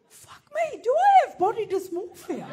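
high, loud mock panic; hammed-up shock, voice way up; mock alarm. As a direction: squeal it in fake horror. Mock panic at her answer.

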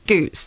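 "goose" for cows, long oo vowel; Scottish pronunciation. Shown as a demonstration. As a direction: repeat cows the Scots way, as "goose". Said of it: "that's how they say it".

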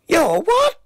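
high-pitched startled cry. A narrator's reading. Boy answering the voice in his gob, startled cry. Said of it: "cried out".

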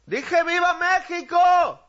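high, slow, stretched repeat cheer; insistent shout. Acted. Official repeating to a crowd that ignores him, shouting insistently. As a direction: demand an answer from the crowd.

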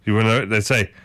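ordinary talk, nothing marked

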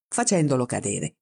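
plain reading voice

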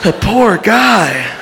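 high slow sympathetic voice; high, slow pity. Pretend. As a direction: pity him, drawn out. Bystander version.

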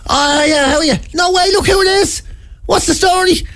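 high-pitched hearty greeting, imitated; over-friendly fella. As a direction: greet a spotted celebrity in a high voice. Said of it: "a fella rang up and he goes".

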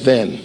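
"Then." short and falling; stress on then. Shown as a demonstration. Preacher quoting verse fourteen, stressing "Then.". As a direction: drop on "Then." with weight. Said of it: "it says".

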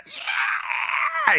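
very high, held-back scream; mock blood-curdling scream. Shown as a demonstration. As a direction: imitate a blood-curdling scream at reduced volume. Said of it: "it's just a blood-curdling scream".

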